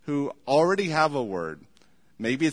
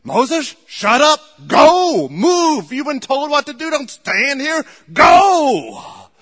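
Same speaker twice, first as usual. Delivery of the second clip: booming, much louder and higher command; God's rebuke; 10 semitones higher, 13 dB louder, slower than usual. Acted. Preacher voicing God ordering Moses to move, booming shout. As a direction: bark it like an impatient God.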